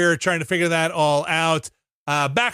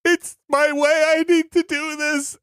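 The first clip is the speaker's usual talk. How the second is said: dying hero; 12 semitones higher than usual. Retold or imitated. Describing a spicy meal, hammed-up heroic farewell. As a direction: play the doomed hero walking into the sun. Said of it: "just being like".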